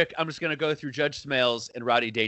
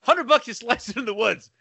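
mock Dangerfield bet; 8 semitones higher than usual. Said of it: "he's like".

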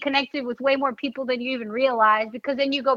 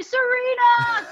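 high, drawn-out cheer of her name; fans calling out; 9 semitones higher, 5 dB louder, slower than usual. Retold or imitated. Fans outside the stadium cheering for her arrival, loud drawn-out call.

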